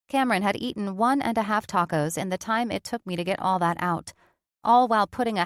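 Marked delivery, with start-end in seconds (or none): none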